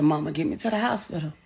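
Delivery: hushed voicing of her shot son; quiet plea. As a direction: hold it low and steady like he's fading. Wounded son's quiet plea retold.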